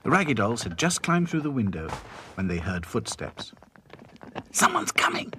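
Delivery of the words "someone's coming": urgent unvoiced hiss; frightened doll whispering to the others. A narrator's reading. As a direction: hiss a warning to hide. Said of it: "hissed Dotty".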